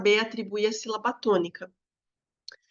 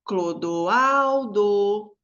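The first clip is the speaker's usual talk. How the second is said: CLODOALDO called very slowly, AL held long and strong; drawn-out "Clodoaldo", weight on AL; calling a name; 4 dB louder, much slower than usual. Shown as a demonstration. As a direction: stretch the AL of Clodoaldo as if calling someone. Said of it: "qual eu falo mais forte".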